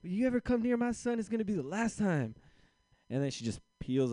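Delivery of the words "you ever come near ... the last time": high, loud, mock-screamed insult; furious mom yell. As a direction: chew the kid out in a rage. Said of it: "it's all in Spanish".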